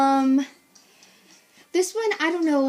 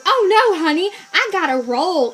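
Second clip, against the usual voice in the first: mock Southern country accent, higher and louder; 5 semitones higher, 7 dB louder than usual. Lady refusing to help, in a put-on country accent. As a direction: do the lady's country accent, breezy. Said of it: "she's got this country accent".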